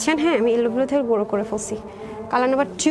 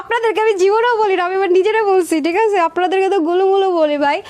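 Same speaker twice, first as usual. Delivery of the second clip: higher and louder, playfully teasing the viewers; 6 semitones higher, 5 dB louder than usual. Real, not acted. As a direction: tease them loudly and fondly, like a big sister joking. Teasing viewers about being chubby. (মজা করে উঁচু গলায় খুনসুটি)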